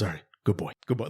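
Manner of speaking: put-on low, soft good boy; deep fatherly approval. Father praises son's friend, low and soft. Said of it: "Like, literally like that".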